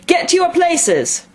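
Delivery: slow, high, commanding shout. A narrator's reading. Queen orders players to their places, shouting. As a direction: bellow it out at high pitch. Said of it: "shouted the Queen".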